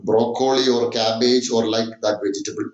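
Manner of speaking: ordinary delivery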